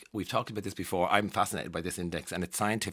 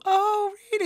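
mock surprise; 5 dB louder than usual. People learning what Tato means, mock surprise. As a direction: imitate someone only now catching on. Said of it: "some people will go".

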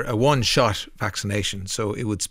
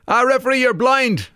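mock crowd shout; 11 semitones higher, 3 dB louder than usual. Crowd cry quoted in poem.

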